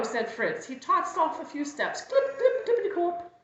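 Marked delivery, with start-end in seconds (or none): 2.0-3.3 high, bouncy clip-clop; dancing hoof sounds. Acted. Goat shows sheep his dance steps, high chirped clip-clop. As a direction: tap out the hooves in a high, loud voice.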